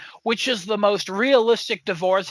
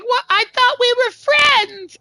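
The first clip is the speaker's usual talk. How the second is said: betrayed elephant; 4 dB louder than usual. Retold or imitated. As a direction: sound like a betrayed little toy. Elephant after ear ripped off.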